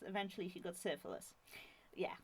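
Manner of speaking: ordinary talk, nothing marked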